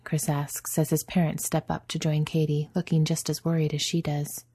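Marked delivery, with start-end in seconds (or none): none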